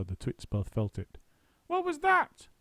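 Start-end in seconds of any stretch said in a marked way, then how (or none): 1.7-2.5 put-on high, startled old woman cry; crying out Missus Twit. A narrator's reading. Missus Twit startled by glue on her head. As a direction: yelp it in alarm. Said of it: "cried Missus Twit".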